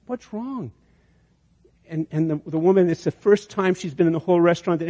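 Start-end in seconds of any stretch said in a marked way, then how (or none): 0.0-0.7 soft, high, gentle worry; much softer and higher, full of comfort; tender concern. Retold or imitated. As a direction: coo it softly to a crying young mother. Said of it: "the older woman says".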